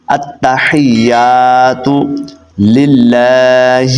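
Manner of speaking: slow tajweed model of التحیات; correct recitation. Shown as a demonstration. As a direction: chant التحیات very slowly with a clear ح and a long held "yaa". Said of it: "تو کیا کرنا ہے؟".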